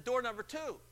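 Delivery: high, breathless yes yes yes; eager caller. Retold or imitated. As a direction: rush it like a thrilled winner. Caller picks door.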